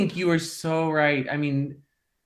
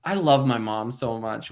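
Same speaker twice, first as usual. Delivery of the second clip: usual speaking voice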